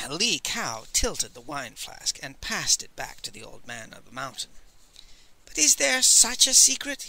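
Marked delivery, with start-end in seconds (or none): 5.5-7.0 higher, louder musing scholar; wondering. A narrator's reading. Master Li wonders aloud to the Old Man, high and curious. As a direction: puzzle aloud, half doubting. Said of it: "he wondered".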